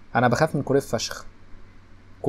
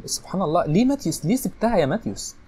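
much higher, pleading cry; outraged disbelief; 7 semitones higher than usual. Real, not acted. Gamer crying out at Matthäus for missing, high and disbelieving. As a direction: wail at him for letting it in.

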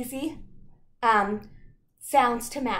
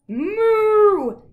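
loud cow moo; 10 semitones higher, 13 dB louder than usual. Acted. Cows mooing in story.

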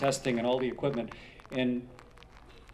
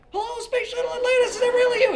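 squeaky, much higher and louder, thrilled; voice up two octaves; 10 dB louder, faster than usual. Retold or imitated. Ham operator hearing the shuttle answer, voice shooting up two octaves. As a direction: jump way up in pitch with excitement. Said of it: "His voice went up, like, two octaves".

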